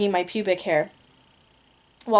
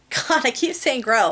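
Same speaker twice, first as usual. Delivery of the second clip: amused, laughing; laughing at self; 4 semitones higher than usual. Real, not acted. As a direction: laugh at your own slip. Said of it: "laughs God".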